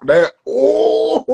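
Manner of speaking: high "ooh" tipping into a laugh; scandalised whoop, then laugh it off; shocked laugh. Real, not acted. Reacting to a shocking remark.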